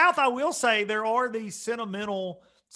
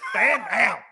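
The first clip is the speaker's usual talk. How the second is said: a louder repeat of the order; mock command; 4 semitones higher, 7 dB louder than usual. Repeating the joke order, a bit louder. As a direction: snap the order again. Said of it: "I might do some stuff like that".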